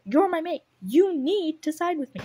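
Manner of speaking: loud, high acted pleading; insistent demand. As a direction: demand loyalty, pushing each phrase.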